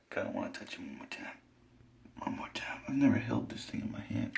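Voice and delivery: soft unvoiced whisper; hushed whisper over the dead fish. Real, not acted. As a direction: barely breathe the words. Quiet farewell to the fish.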